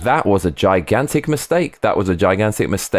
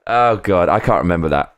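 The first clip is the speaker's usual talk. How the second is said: usual speaking voice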